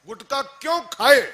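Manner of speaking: high, loud, accusing question; scolding rebuke. Real, not acted. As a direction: demand why they ate it. Preacher rebukes listener. (डांटते हुए ऊँची आवाज़ में)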